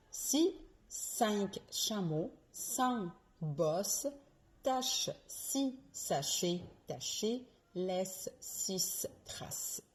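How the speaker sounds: "Si cinq chameaux sans bosse" slowly, S hissed, CH hushed; slow S and CH drill. Shown as a demonstration. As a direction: separate every S from every CH. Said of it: "La deuxième virlangue que je vous propose". (virelangue lente, S et CH bien distincts)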